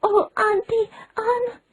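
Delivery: put-on milk every word like a dying child.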